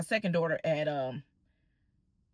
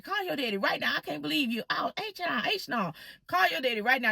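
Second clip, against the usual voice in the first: high-pitched imitation of an appalled mother; mock scolding, pitched up but not shouted; upset mother; 6 semitones higher than usual.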